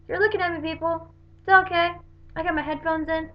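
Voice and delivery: chirpy mock-innocent reply to staring strangers; breezy brush-off. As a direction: shrug the stares off lightly. Unbothered retort to staring passengers.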